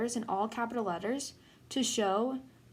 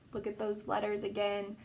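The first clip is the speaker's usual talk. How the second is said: ordinary delivery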